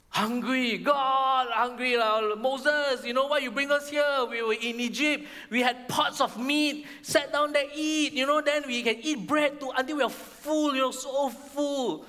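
high, hammed-up hungry griping; complaining. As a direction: whine theatrically at the leader in charge. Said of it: "then they complained again".

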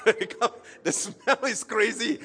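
high, loud, laughing through the words. Real, not acted. Pastor recalling a smelly youth game, laughing. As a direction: tell it while cracking up.

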